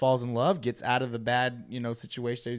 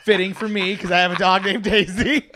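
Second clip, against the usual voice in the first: talking through a laugh, louder; 6 semitones higher, 9 dB louder than usual. Real, not acted. Telling his cohost about his dog Daisy, laughing.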